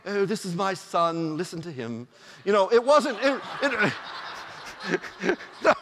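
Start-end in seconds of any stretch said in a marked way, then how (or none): 0.1-2.1 gentle mock English accent, trailing softly; kind English voice. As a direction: put on a kindly, genteel English God. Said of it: "it wasn't a kind English voice".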